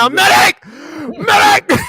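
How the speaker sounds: high, loud battlefield call for a medic; yelling for medic. Retold or imitated. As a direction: cry out like a soldier needing help. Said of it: "I be yelling".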